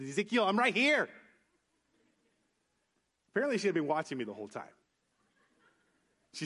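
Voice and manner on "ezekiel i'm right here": higher, raised-pitch retort from a parent; scolding mom. Retold or imitated. Mom to her crying son, exasperated. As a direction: imitate a mother making her presence known. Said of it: "She said".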